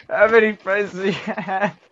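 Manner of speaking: laughter bursting through the words; laughing so hard. Real, not acted. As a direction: question cracked up by real laughter. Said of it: "I'm laughing so hard".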